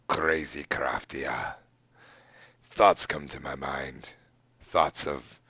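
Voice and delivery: low, hushed, slow storyteller voice; hammed-up whispered narration; mock dramatic narrator whisper.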